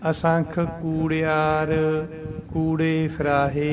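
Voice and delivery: slow recitation with a break after ਕੂੜਿਆਰ; pause after ਕੂੜਿਆਰ. Shown as a demonstration. Teacher recites with a pause after ਕੂੜਿਆਰ. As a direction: rest on ਕੂੜਿਆਰ then go on. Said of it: "ਇੱਥੇ ਕੂੜਿਆਰ ਤੇ ਵਿਸ਼ਰਾਮ ਹੈ".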